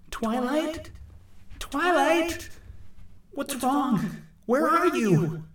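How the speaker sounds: very high, echoing calls; high-pitched, anxious echoing cry; anxious calling. Acted. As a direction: call out searching, drawing out the words.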